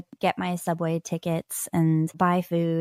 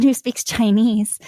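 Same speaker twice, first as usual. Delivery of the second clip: ordinary talk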